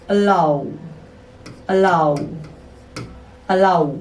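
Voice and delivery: अलाउ said three times, ending on ow; repeated drill. Shown as a demonstration. Teacher repeating the word for learners to copy. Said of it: "देखिए".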